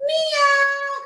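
very high little meow; cat meow. Shown as a demonstration. Showing Janie what the cat says, high meow. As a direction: mew like a tiny cat. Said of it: "what sound does the cat make?".

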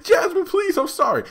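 very high, pleading squeak; squeaky begging. Retold or imitated. Andy begging Jasmine, high and pleading. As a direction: beg for your life.

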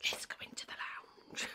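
soft whisper into the lounge. Real, not acted. Whispering so the cat stays calm. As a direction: whisper so the cat won't hear. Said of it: "Because I'm whispering".

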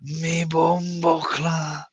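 slow, drawn-out mock Jamaican accent; lazy Jamaican accent, dragged slowly. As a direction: stretch it out in a playful Jamaican accent. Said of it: "if I had a Jamaican accent".

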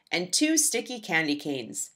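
plain reading voice

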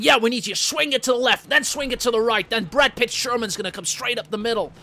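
loud, high, pushy director voice; hyped Hollywood director. Retold or imitated. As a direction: bark orders like a blockbuster director. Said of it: "in a Michael Bay-esque fashion".

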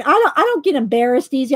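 ordinary delivery

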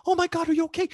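very high, loud mock panic; hammed-up alarmed shriek; panicked parent. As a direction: freak out over a fallen child. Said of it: "And you panic".

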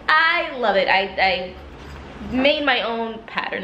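ordinary talk, nothing marked

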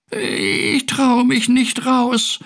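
high, slow, tearful little cat; frightened acted sob; sobbing. A narrator's reading. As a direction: whimper it very high and drawn out. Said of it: "schluchzte Findus".

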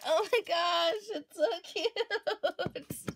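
high squeal stretching into a giggle; gush, drawing it out slowly in delight; excited gushing. Real, not acted. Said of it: "I'm so excited!".

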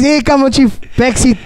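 high, pushy command; bossy Pope. Retold or imitated. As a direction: order him into the back. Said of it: "So the Pope says".

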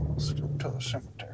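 quick hushed whisper. Real, not acted. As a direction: hurry it out under your breath. Hushed at the graveyard.

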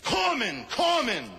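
high shouted call across the beach; yelled name. Acted. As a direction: scream the name in anguish. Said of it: "He yells out".

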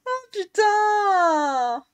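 real exasperation; drawn-out exasperated groan-yell. Real, not acted. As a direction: let the curse out slowly in disbelief. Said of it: "je commence à gueuler".

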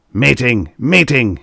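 crying out. A narrator's reading. Angry producer summons his staff, crying out. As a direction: bellow it as a summons. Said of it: "he cried".

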